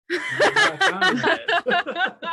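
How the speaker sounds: high squeaky laugh-through; giggling through the words. Pretend. Mocking repeat while laughing.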